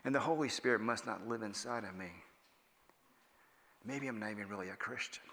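soft, falling, worried inner voice; a touch of guilty self-doubt; anxious self-talk. Retold or imitated. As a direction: murmur your doubts to yourself. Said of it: "I'm thinking".

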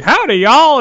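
high, loud hammed-up cowboy twang; cowboy accent. Wrestler forced into cowboy gimmick, loud mock cowboy accent. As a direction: belt it like a fake Texan cowboy accent. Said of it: "come out with an accent and be like".

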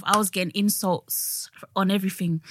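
ordinary talk, nothing marked

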